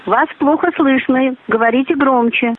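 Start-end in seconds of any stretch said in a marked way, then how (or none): none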